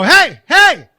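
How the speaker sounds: loud call across an airport; retold yell. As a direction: shout to get her attention. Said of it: "I just screamed over to her".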